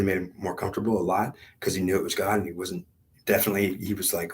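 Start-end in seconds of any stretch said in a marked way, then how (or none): none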